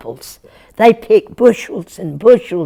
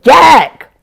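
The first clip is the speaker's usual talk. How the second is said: loud, high call; shouted call; 10 semitones higher, 8 dB louder than usual. Acted. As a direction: holler his name across the yard. Farmer calls out to Jack.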